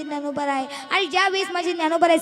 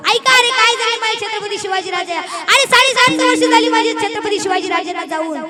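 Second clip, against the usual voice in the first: loud, drawn-out kirtan preaching; fiery preaching; 6 semitones higher, 12 dB louder than usual. Acted. Preacher rousing the kirtan audience, fiery loud praise. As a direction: thunder the praise to the crowd.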